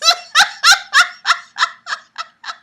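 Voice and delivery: very high, loud burst of laughter; shrieking laughter. Real, not acted. Reader cracking up at the dialogue, shrieking laughter. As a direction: crack up helplessly in squeals.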